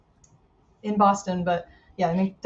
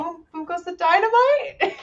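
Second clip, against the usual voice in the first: excited call; 8 semitones higher than usual. Real, not acted. Catchphrase call on big score.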